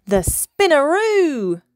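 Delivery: high, loud call with a long swoop; excited cry. A narrator's reading. Little dolphin announces her spin move, crying out. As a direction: swoop up big on the name. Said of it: "cried Minnie".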